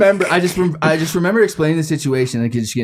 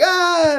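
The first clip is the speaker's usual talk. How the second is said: high mock scream back; yelled Ah. Son yelling back at his dad, a mock scream. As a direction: yell it straight back. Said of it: "he was like".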